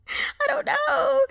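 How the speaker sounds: high, slow, laughing I don't know; laughing helplessly. Real, not acted. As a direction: give up with a laugh. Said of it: "laughing at her voicing trouble".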